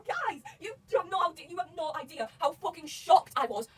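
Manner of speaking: high, slow, emphatic outburst; loud, excited shock. Real, not acted. Fan telling viewers how shocked they were, loud and excited. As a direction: stretch every word out in loud amazement. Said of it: "I am a really loud person, I'm excited".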